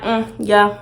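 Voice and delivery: low, quiet, unenthused hums; cold and dismissive. Retold or imitated. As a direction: give him nothing, flat hums. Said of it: "It's gonna be like".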